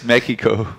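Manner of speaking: "Mexico" as "meh-KEE-ko"; slow "Mexico" with k replacing the x; mispronunciation shown. Shown as a demonstration. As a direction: put a hard k in "Mexico", no s. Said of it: "Nobody says Mexico".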